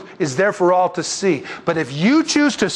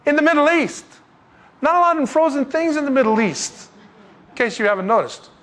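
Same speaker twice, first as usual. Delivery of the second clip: emphatic preaching; 9 semitones higher than usual. Acted.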